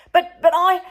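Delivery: high, loud flustered stammer; sputtering innocence. Acted. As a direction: stumble over the words in panic. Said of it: "began sputtering her innocence".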